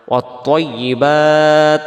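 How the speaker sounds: ওয়াত্তাইয়িবাত with heavy "to" and long final "baat"; slow recitation closing ওয়াত্তাইয়িবাত on t; stopping form. Shown as a demonstration. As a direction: chant ওয়াত্তাইয়িবাত, ending on a held vowel. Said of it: "যদি আমরা এখানে দমটা ছেড়ে দিই".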